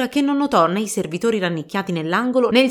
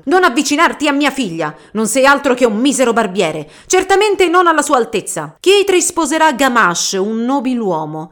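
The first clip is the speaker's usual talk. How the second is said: loud, higher, scolding rant; angry father; 4 semitones higher, 6 dB louder than usual. Acted. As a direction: berate the barber from the doorway. Angry father scolds daughter's suitor.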